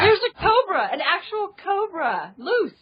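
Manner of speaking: excited. Real, not acted. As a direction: blurt it out excitedly. Excited news about a cobra.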